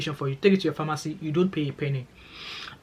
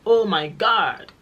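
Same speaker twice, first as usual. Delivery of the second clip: drawn-out, higher, gushing "oh my God"; gushing admiration; 5 semitones higher, 4 dB louder, much slower than usual. Real, not acted. Vlogger gushing over dressed-up Scottish girls, slow and high. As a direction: swoon over their glam looks, slowly.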